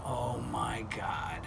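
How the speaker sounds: slow breathless whisper of realisation; unvoiced slow oh my God; whispered dread. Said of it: "I was like".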